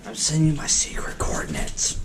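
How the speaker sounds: secretive whisper. Acted. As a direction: breathe it like a secret. Secret message to Corvus.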